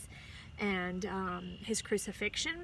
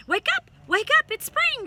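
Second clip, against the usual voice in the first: very high, loud cheer; 12 dB louder than usual. A narrator's reading. Dog cheering in the baby's room, very high and loud. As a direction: bark it out with joy. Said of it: "cheered the dog".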